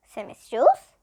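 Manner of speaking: usual speaking voice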